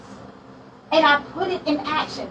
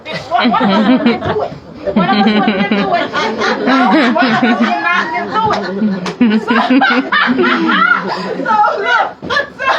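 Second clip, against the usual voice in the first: laughing; 2 semitones lower, 4 dB louder than usual. Real, not acted. Laughing punchline to audience.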